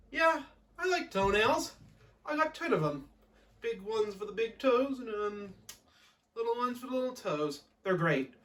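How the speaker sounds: high, simple-minded testimonial voice; hesitant interviewee in a mock advert; halting, high-pitched happy customer; Cyrano. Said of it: "We spoke to Cyrano of Misty Lake".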